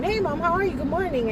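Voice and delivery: high bright imitation of her son's cheerful hello; chipper greeting. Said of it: "You walk in here in a good mood".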